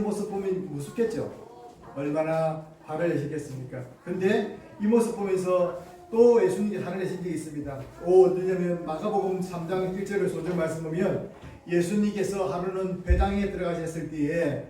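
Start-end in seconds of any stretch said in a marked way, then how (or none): none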